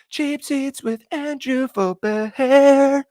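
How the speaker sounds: slow, drawn-out announcer intro; show intro voice. Pretend. Streamer mock-announces his own show, slow and grand. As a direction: announce the show title like a big broadcast opener. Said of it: "I need to get an intro like that".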